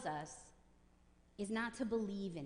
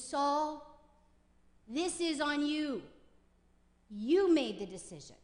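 accusing emphasis; 4 semitones higher, 6 dB louder than usual. Retold or imitated. Preacher voicing blame on Saul.

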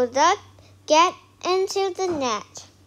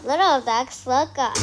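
plain reading voice

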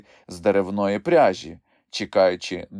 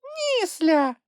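very high tiny creature outburst; high-pitched small creature's protest; squeaky exclamation. A narrator's reading. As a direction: cry out in a little squeak. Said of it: "вигукнула Трясля".

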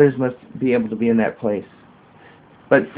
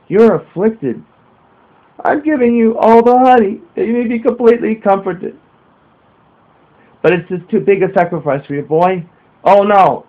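put-on higher, louder young boy voice; boy's voice; 7 semitones higher, 3 dB louder than usual. A narrator's reading. Boy offering John his honey, higher child voice. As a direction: play the eager little boy.

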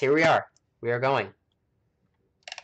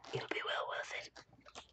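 unvoiced hushed whisper; 15 dB quieter than usual. Real, not acted. Whispering a promise to viewers to watch the stream.

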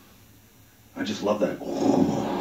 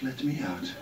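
the same plea, softer and fading away; fading echo; 7 dB quieter than usual. Retold or imitated. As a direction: let it trail off like a distant echo. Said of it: "people running around in my head going".

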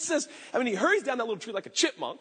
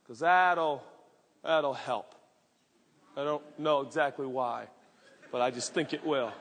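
low, deadpan irony; dry sarcasm; 9 semitones lower than usual. Real, not acted. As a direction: say it flat and knowing. Sarcastic aside about sad repentance.

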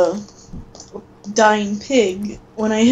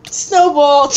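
loud playful yell as you throw; shouted battle cry; 4 semitones higher, 6 dB louder than usual. Pretend. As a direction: shout it like an attack. Throwing a snowball at friend.

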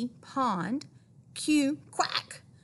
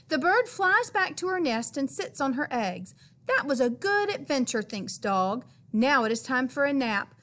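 ordinary delivery

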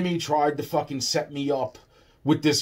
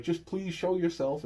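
soft, raised, pleading little voice; retold plea; 5 semitones higher, 5 dB quieter than usual. Man retelling his call to the frog, soft pleading. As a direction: plead gently, higher and softer. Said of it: "I was like".

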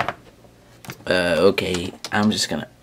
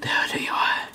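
vengeful whisper; 6 semitones lower, 13 dB quieter than usual. Acted. Hiding from the enemy, whispering a vow of vengeance. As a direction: whisper a dark oath under your breath.

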